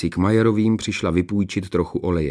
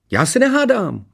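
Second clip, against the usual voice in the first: very high, indignant retort; snapped denial; 4 dB louder than usual. A narrator's reading. Princess talking back to her father, snapping. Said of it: "odsekla Ksenie".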